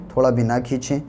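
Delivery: usual speaking voice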